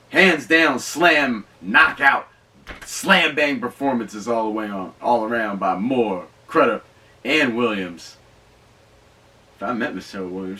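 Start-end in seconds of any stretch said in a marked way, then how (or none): 0.1-8.2 loud, punchy praise, building up each name; excited hype. Real, not acted. Vlogger pumping up the cast to his viewers, loud and excited. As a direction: sell the cast like a ringside announcer. Hyping the performances.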